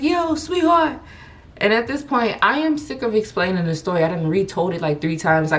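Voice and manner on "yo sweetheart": high, drawn-out catcalling guy; mock flirty street call. Guys in van catcalling her.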